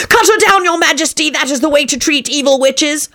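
high, urgent villain voice; scheming charlatan. A narrator's reading. Charlatan urging the king to fell the tree, high and urgent. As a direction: urge it in a high screech. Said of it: "he added".

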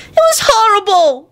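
put-on high-pitched child's wail. A narrator's reading. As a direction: wail it like an upset little boy. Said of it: "wailed Teddy".

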